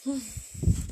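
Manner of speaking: long sigh, breath let out; sigh of relief. Real, not acted. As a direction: exhale after holding breath. Releasing a held breath.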